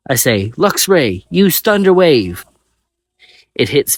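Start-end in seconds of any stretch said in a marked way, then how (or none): 0.6-2.4 loud, slow commanding call; battle command. A narrator's reading. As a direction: bark the attack like a trainer. Trainer giving command.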